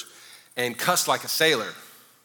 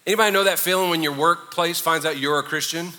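normal talking voice

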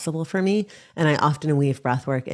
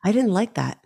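lightly voiced little boy, pitched higher; a hint of a child's protest, higher pitched; slightly higher voice for a young son; small child; 6 semitones higher than usual. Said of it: "Or he might say".

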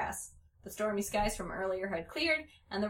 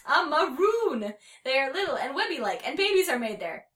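exasperated explaining; 5 semitones higher, 6 dB louder than usual. A narrator's reading.

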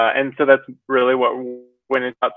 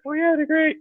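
put-on high, light girly voice; man putting on a high girl voice; 14 semitones higher than usual. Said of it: "that's my girl voice".

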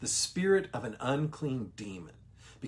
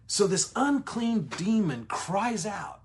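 raised higher and slowed, dramatic; dramatic reading; 7 semitones higher, 4 dB louder, slower than usual. Shown as a demonstration. Teacher dramatizing the demon's outcry for viewers, raised and slow. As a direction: read it like a climax in the story. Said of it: "I was trying to be a little dramatic there".

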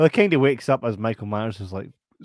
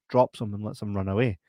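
ordinary talk, nothing marked

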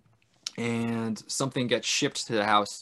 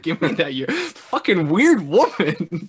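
louder, higher, drawn-out insult; hammed-up annoyance, pitched up; mock exasperation; 6 semitones higher, 5 dB louder, slower than usual. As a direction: act out fed-up disbelief. Acts out exasperated man at woman.

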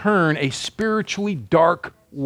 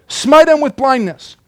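higher, louder punchy command; bold repeat; 7 semitones higher, 4 dB louder than usual. Acted. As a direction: hit it harder the second time. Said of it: "That doesn't sound very Christ-like".

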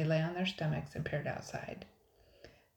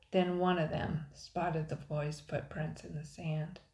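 ordinary delivery

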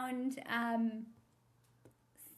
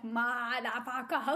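slow, drawn-out odd motherfucker; Tommy Wiseau delivery; slower than usual. Retold or imitated. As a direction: mimic the strange movie delivery. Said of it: "Like, the way he says".

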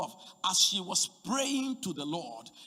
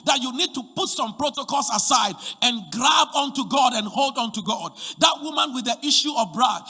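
loud, rising sermon delivery; fiery preaching; 4 semitones higher, 7 dB louder than usual. Real, not acted. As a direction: preach it full force to the congregation. Preaching at full force.

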